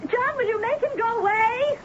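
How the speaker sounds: high-pitched call from upstairs. Acted. Wife calling down to John to stop the knocking, high and strained. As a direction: cry out to a husband far below.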